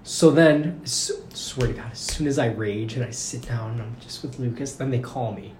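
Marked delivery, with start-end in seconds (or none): none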